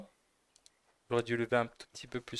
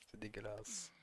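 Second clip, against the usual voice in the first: whispering; 7 dB quieter than usual. Real, not acted. Disgust at cleaning bow.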